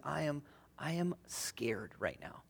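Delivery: frightened hushed whisper; scared whisper. Retold or imitated. Woman in labor whispers her fear to the midwife. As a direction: breathe it out, barely voiced and afraid.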